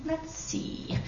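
breathy thinking whisper. Acted. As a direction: whisper it to yourself. Horton thinking to himself.

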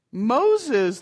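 mocking laugh-word. Burglar scoffs at the parrot's name, mild laugh. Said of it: "the burglar laughed".

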